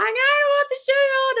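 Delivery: put-on high, whining little-kid voice; whiny crying toddler. Toddler whining at mum about his shoe, crying. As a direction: mimic a toddler refusing a shoe. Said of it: "now they're crying".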